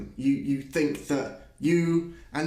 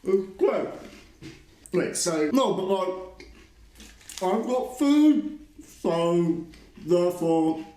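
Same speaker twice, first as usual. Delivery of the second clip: mocking parody voice. S1 mocks with a parody voice.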